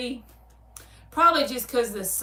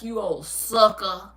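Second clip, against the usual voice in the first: sitcom aunt insult; 5 dB louder, slower than usual. Retold or imitated. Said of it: "She'd be like".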